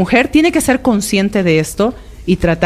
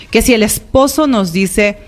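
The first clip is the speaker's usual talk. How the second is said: normal talking voice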